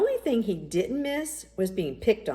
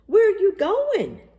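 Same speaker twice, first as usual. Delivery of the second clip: put-on much higher, louder call after a running boy; yelled after him; 14 semitones higher, 8 dB louder than usual. A narrator's reading. Daddy calling after his son who ran off, loud yell. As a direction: shout it after him as he runs off. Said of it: "Daddy yelled after him".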